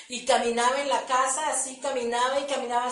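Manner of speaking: ordinary talk, nothing marked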